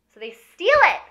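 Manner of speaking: high, loud gleeful exclaim; thrilled. Real, not acted. Storyteller telling viewers about the theft, thrilled. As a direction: announce it with delight.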